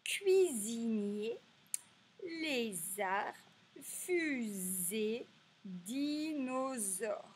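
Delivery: each word drawn out, pause between; slow word-list recap. Shown as a demonstration. Teacher repeating the words seen, slow and stretched. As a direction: recite the list slowly, stretching the syllables. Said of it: "je répète, nous avons vu".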